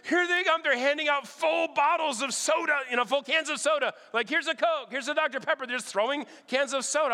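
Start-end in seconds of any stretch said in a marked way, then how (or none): 0.1-5.7 higher, excited rush of a thrilled neighbour. Retold or imitated. Neighbour announcing parade freebies, voiced higher and excited.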